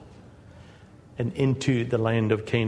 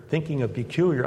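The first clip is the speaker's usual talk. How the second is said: normal talking voice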